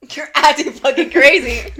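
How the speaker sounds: loud, slow mock retort, laughing; mock shout. Friend jumping into the story, laughing mock yell. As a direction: fling it back laughing.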